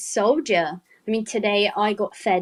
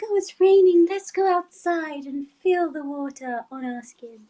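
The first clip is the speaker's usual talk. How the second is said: dreamy Anya; 7 semitones higher than usual. Retold or imitated. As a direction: gush about the rain like a dreamer. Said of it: "And then Anya's like".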